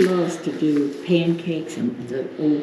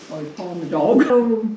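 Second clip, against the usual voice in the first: ordinary talk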